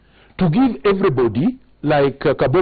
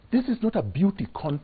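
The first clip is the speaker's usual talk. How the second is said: softer, measured objection; restrained rebuke; 8 dB quieter than usual. Real, not acted. Candidate quietly setting the TV host straight, restrained rebuke. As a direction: make the point quietly, holding back.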